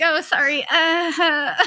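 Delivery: high, whimpering fake apology; hammed-up sheepish whine; mock apology. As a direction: plead sorry in a small, high voice. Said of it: "I'm like".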